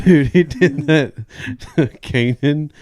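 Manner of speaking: words through laughter; laughing. Real, not acted. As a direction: laugh while blurting it out. Laughing at the joke.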